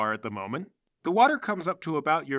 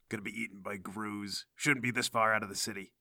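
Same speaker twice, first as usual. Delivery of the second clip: much softer, lower grumbling; muttering; 6 semitones lower, 8 dB quieter than usual. Acted. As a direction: grumble dangers under your breath. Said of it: "She mutters occasionally about the dangers".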